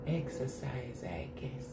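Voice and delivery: hushed, toneless whisper; whispered read. Real, not acted. Streamer reading a viewer's comment to self, whispered.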